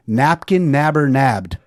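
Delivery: slow, punchy headline read of "Napkin nabber nabbed.". Retold or imitated. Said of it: "it literally said".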